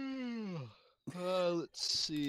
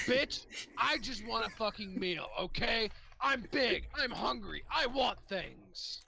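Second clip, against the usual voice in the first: higher and louder, a hungry ogre's outburst; loud, higher ogre insisting on food; indignant ogre; 8 semitones higher, 3 dB louder than usual. Acted. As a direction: bellow it like a starving brute who's fed up. Hungry ogre demanding a meal.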